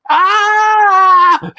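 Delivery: scream. Pretend. Surprised GM reacts to dagger hits.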